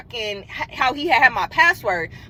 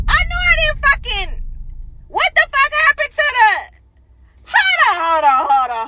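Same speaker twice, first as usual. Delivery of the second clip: retold panicked disbelief; 10 semitones higher than usual. Telling viewers the address message vanished, high panicked disbelief. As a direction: drag out the shock at high pitch. Retelling discovery of missing message.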